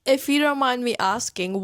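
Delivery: ordinary delivery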